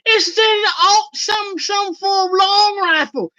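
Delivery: high, slow, vague voice; imitating the unsure wife; mock wife voice. Mimicking the wife naming the ammo type, slow and high. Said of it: "she was like".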